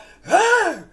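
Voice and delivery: sudden loud high gasp, mimicking the dogs; sharp gasp. Imitating the dogs' strained barking.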